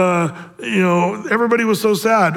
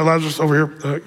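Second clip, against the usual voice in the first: angel breaking bad news; 3 semitones lower than usual. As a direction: gently let him down. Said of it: "Gabriel comes over".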